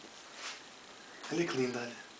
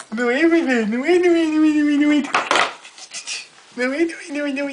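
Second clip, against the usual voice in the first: high, louder repeated sing-song; 4 semitones higher, 6 dB louder, much faster than usual. Real, not acted. Mother cooing at baby. (cantarolar alto para o bebé)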